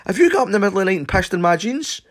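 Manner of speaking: high, loud and fast interrogation; rapid accusation. Retold or imitated. Interrogating his sleepy friend about the wet jeans, fast and loud. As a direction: fire the accusation off quickly.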